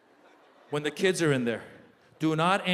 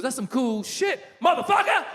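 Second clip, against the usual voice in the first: brash rapper friend; 6 semitones higher than usual. Retold or imitated. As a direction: hype it up like a rapper.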